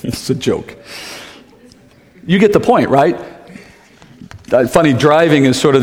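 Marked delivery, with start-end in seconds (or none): 0.0-0.8 laughing it off. Real, not acted.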